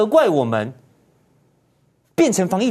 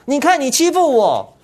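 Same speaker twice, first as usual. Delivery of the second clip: higher, whiny victim voice, a bit louder; mocking the party's endless grievances, whiny and high; mock whining complaint; 6 semitones higher, 3 dB louder than usual. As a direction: whine like someone picked on and pointing fingers. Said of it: "整天骂中国大陆".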